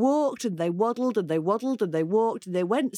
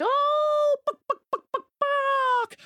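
very high laughing clucks; high clucking with a laugh. A narrator's reading. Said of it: "they said".

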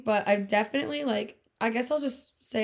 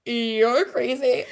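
laughing; 2 semitones higher, 6 dB louder, much slower than usual. Retold or imitated. Dismissing jealous guy, laughing.

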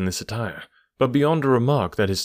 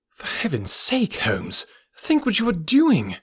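cried out; 8 semitones higher than usual. Acted. Watson pleading with Holmes not to burgle, high anguished cry. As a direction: beg him to stop, rising to a cry. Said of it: "I cried".

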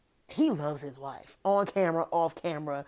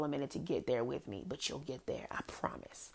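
hushed promise, all breath and no voice; whispered reassurance; 4 semitones lower, 9 dB quieter than usual. Real, not acted. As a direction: drop to a near-silent whisper.

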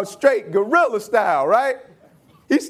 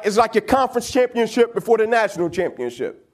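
ordinary delivery

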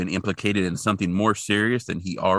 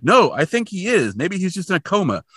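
higher, eager insisting; mock Scully; 8 semitones higher than usual. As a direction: insist brightly, a bit higher. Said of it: "And Scully's like".

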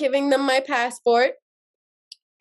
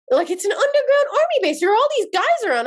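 indignant protest; 10 semitones higher than usual. Retold or imitated. Protesting the strip search.

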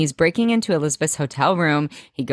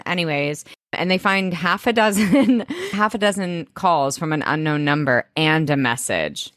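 ordinary talk, nothing marked

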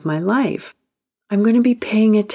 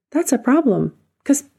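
"That's a problem" pitched up and punched on "That's"; higher emphatic restatement; 7 semitones higher than usual. Acted. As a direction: lift it high and land it hard.

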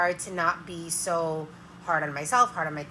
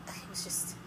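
breathy exasperated whisper; whispered frustration; 3 semitones lower, 14 dB quieter than usual. Real, not acted. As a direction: let it fall to a whisper. Overwhelmed, drops to whisper.

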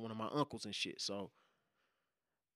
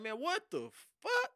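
high disbelieving cry; shocked. Retold or imitated. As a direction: say it stunned. Sees armed officer.

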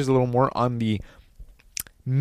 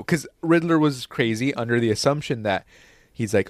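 ordinary talk

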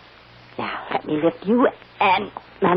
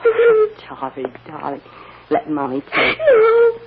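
high, breaking with tears; tearful mother; 12 semitones higher, 5 dB louder than usual. Acted. Mother finding her lost daughter, weeping, higher. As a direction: cry out over the child you found.

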